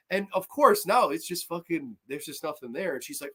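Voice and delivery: ordinary talk, nothing marked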